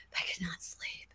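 hushed whisper, no voice at all. Acted. Telling listeners of a sleepless night, whispered. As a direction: breathe it like a secret in the dark.

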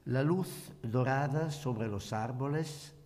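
ordinary talk, nothing marked

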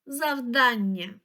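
Завдання said slowly, stretched on дан; stress on second syllable. Shown as a demonstration. Teacher repeating the dictionary word, second syllable of Завдання stressed. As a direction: stretch the stressed middle syllable, zav-DAN-nya, in Завдання. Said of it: "Наголос на другий склад".

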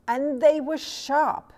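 a gleeful emphatic finish. A narrator's reading. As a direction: land the punchline hard. Climax of the bite.

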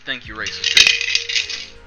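breathy mock-polite whisper; slow whisper. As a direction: hush it out slowly, mock gracious. Whispered slow sign-off to user.

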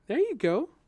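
high, loud laughing cheer. A narrator's reading. She cheers his first movement, laughing. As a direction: laugh it out in delight. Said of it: "she laughed".

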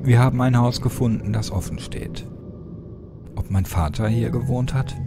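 ordinary delivery, nothing marked

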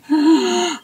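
loud high grunt on a sharp in-breath; raspy inhaling bull elk grunt; inhaled bull grunt. Shown as a demonstration. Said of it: "So I'm inhaling".

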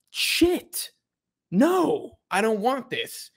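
high-pitched, drawn-out dismayed fan; mock dismay. Fan reacting to league making money, high mock dismay. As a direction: let the voice leap up in disbelief.

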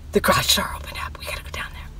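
hushed whisper of the alarmed son-in-law. Retold or imitated. Retelling the son-in-law at night, whispered. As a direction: whisper it like someone might hear.

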